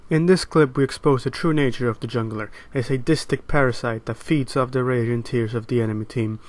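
calm, measured wildlife documentary voice; nature documentary narrator. Acted.

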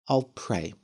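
forced calm. Acted. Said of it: "as calmly as possible".

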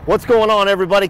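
high, loud show-host greeting. Real, not acted. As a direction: call it out to the camera. Loud vlog greeting to camera.

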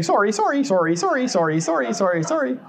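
high, rapid-fire chorus of sorries; honking geese apologizing. Acted. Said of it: "instead of just honking, they're saying".

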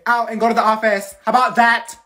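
loud, high furious command; angry order. Acted. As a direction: throw them out angrily. Teacher sending student out.

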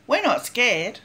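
usual speaking voice

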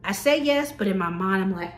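ordinary talk

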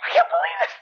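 very high-pitched hysterical shriek; screaming. Acted. Mother reacting to her son's confession, screaming. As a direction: wail it in a hammed-up falsetto. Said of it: "She starts screaming".

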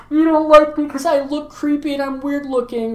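high-pitched tearful clown whine; mock crying. Clown guilt-trips the girl walking away, fake crying. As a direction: whine it as if badly faking sobs. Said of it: "it starts crying".

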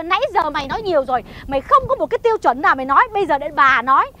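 normal talking voice

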